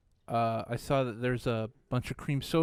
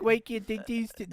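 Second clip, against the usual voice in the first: put-on high whiny kid voice; 9 semitones higher than usual. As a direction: whine it like a child. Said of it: "That would be my kid voice".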